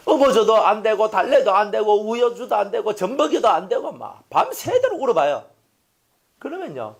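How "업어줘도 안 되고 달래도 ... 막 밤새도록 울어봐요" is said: high, insistent run of complaints; retold exasperation; exasperated parent. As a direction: pile up the failed attempts, pitched up. Worn-out parent listing failed soothing attempts.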